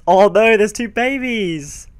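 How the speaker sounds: high, slow delighted cry; excited. Real, not acted. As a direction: squeal at something adorable. Spotting baby horses.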